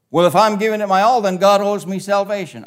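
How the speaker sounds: high, loud and fast, mocking a smug believer; mock entitled believer. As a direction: rattle it off like someone sure God owes him. Preacher voicing a wrong-headed listener.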